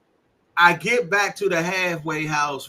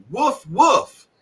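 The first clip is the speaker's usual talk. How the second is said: high spoken woofs; mock dog barks; imitated dog bark; 8 semitones higher, 4 dB louder than usual. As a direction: bark it out to explain. Imitated barking to explain the word.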